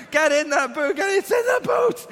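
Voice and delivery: very high, loud playful yell at the lion; mock shout. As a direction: holler at the pretend lion. Dad forcing imaginary lion in.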